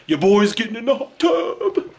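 high, climbing excited whoop; gleeful hype. Real, not acted. To companion on spotting a hot tub, gleeful hype. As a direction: crow it with rising pitch.

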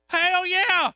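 put-on very high, loud cranky old-man shout; gruff old man. Grumpy patient eagerly accepting the fruit: loud, high cranky shout. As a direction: bellow it like a cantankerous old patient.

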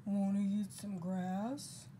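slow, drawn-out cow talk. Acted. Cow inviting spider to eat grass, slow cow voice. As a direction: stretch the question like a mooing cow. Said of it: "said the cow".

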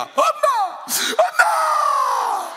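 high, drawn-out mock wailing for mom; imitated daughter's wail. Shown as a demonstration. Preacher shows congregation how daughters mourn, mock wailing. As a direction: cry out as a bereaved daughter, hammed-up. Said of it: "딸은 이렇게 웁니다".

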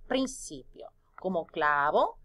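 usual speaking voice

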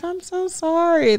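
high teary apology; crying kid. Retold or imitated. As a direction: echo the boy's weepy sorry. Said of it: "he was like".

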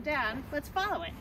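normal talking voice

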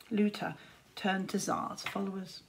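ordinary delivery, nothing marked

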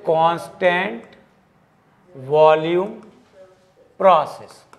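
Constant, volume, process each set apart by a long pause; word-by-word dictation. Shown as a demonstration. Teacher spells out the answer word by word. (Constant... volume... process, हर शब्द अलग-अलग रुककर)

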